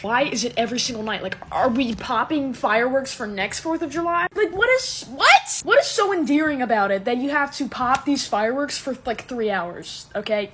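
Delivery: loud, rising comic exasperation; exasperated rant. Real, not acted. Ranting about nightly fireworks.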